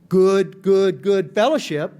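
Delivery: ironic, loud first good, then drawn slowly; mock praise with a loud, pointed first good; sarcastic emphasis. Real, not acted. Telling the AA room about his old group, sarcastic emphasis.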